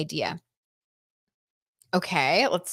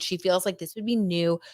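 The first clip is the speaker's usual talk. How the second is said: usual speaking voice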